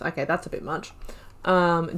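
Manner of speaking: ordinary talk, nothing marked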